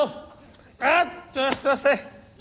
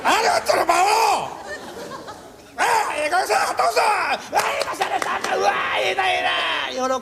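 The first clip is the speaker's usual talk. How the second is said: high, delighted self-praise; tipsy self-congratulation; giddy master; 6 semitones higher than usual. Retold or imitated. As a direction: crow like a tipsy master pleased with himself. Drunk master loving his own act.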